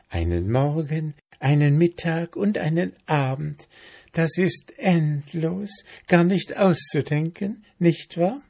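high voice full of wonder; dreamy mayfly. A narrator's reading. Mayfly describing her endless day, rapt.

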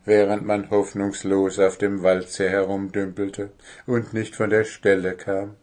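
ordinary delivery, nothing marked

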